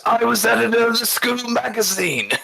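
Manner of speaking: high, strained mock-defiant declaration. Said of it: "raises his fist and he goes".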